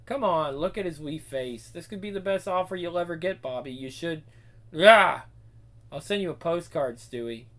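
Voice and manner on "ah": sharp cry. Acted. Sudden startled cry.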